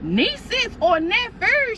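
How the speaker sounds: high, slow astonished question; surprised echo. Retold or imitated. Sister-in-law catches the plural, astonished. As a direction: stretch it out in disbelief.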